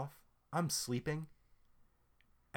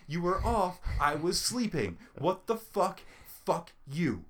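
higher, louder, slow furious yelling; furious rant; 9 semitones higher, 8 dB louder, slower than usual. Retold or imitated. Ranting at his sister for waking him, furious and loud. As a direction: yell it out, every word hammered.

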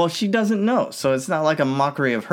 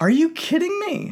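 mock outrage; 14 semitones higher than usual. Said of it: "He's looking at her like".

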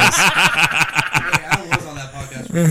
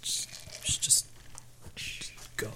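whispering; 16 dB quieter, slower than usual. Real, not acted. Whispered aside during tech trouble.

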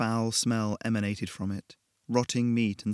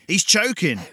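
much higher, much louder urgent call; frantic shout to the staff; shouting for help; 10 semitones higher, 10 dB louder than usual. Acted. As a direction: yell across the shop in panic. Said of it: "he shouted".

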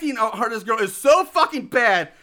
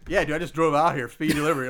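laid-back pizza dude; 7 semitones lower, faster than usual. Retold or imitated. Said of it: "He's like".

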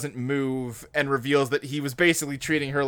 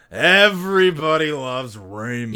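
drawn-out, sarcastic show title; Robert, sarcastic; 4 dB louder, slower than usual. Retold or imitated. Imitating the show's opening, slow and sarcastic. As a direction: say it slowly, dripping with sarcasm. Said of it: "said by Robert sarcastically".

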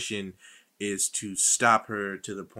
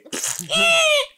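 loud laugh; 8 dB louder than usual. Real, not acted. Laughing after beep bit.

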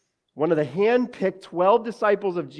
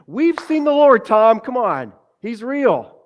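much higher, much louder, bursting with joy; exuberant disciples; 8 semitones higher, 9 dB louder, slower than usual. Retold or imitated. Disciples bursting in on Thomas, exuberant shout. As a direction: disciples rushing to tell Thomas, exuberant and loud. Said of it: "come to Thomas with exuberance".

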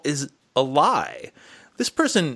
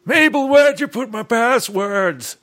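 put-on old man voice; 10 semitones higher, 5 dB louder than usual. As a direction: play a confused grandpa at the computer. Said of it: "say it in an old man voice".

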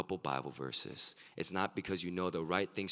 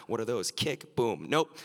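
mock dismissal; 6 semitones higher, 9 dB louder than usual. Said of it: "You're like".

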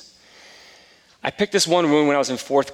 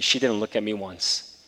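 ordinary talk

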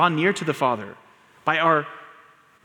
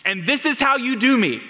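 angry all-caps tweet; 10 semitones higher than usual. Retold or imitated. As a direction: throw the accusation up at God. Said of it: "he tweets".

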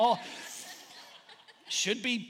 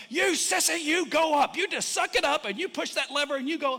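loud, higher-pitched coach berating a sissy; bully yourself like a hard coach; acted drill-coach pep talk, pushed loud; inner coach; 6 semitones higher, 5 dB louder than usual. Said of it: "like, I'm coach, like, there's two of me".

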